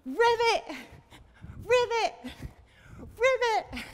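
high, loud croak-word on a jump; frog ribbit. Pretend. Teacher hops with children as a frog, loud high ribbit. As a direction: leap and burst it out like a frog. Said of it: "Let's do it all the way back".

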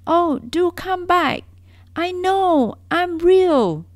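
high, loud, slow pleading; pleading call. Acted. Little rabbit begs the vanished rabbits, high, loud and slow. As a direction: beg them to come back.

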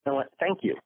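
high little squirrel chatter; imitating a squirrel saying thanks. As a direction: squeak it out like a tiny squirrel. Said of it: "and he went".

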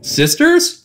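very high questioning quote; quoted musical line. Retold or imitated. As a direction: pitch it way up, mimicking a show line. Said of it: "had the rent in my head".

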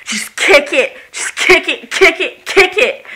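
egging herself on to kick the door; mock fury at the door. Real, not acted. Vlogger urging herself to kick the door, rising loud.

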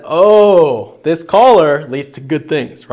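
loud happy dog voice with a slow finish; dog realizing. Retold or imitated. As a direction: play the dog discovering the collar is good. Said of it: "your dog starts to learn that".

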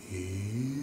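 quiet low E; vocal demo on E; low soft E. Shown as a demonstration. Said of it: "say for me on the letter E".

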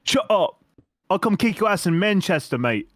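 high, hammed-up British threat; play-fight in a put-on British accent. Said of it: "How's my British accent?".

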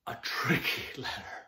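put-on hushed, conspiratorial aside to the kids; secretive whisper. Teacher to young class, whispering that Q is tricky. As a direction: let them in on a secret.

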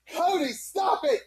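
normal talking voice

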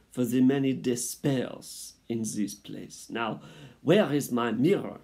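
loud, high, theatrical French mother mouse; French accent. A narrator's reading. Mother mouse names her baby, theatrical French accent. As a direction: declare it grandly with a French accent. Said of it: "She was a French mouse".

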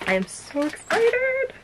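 high, slow, drawn-out squeal of excitement; giddy, squealed emphasis; excited squeal. Real, not acted. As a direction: stretch it out and climb very high. Repeats it as a squeal.

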